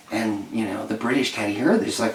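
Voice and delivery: normal talking voice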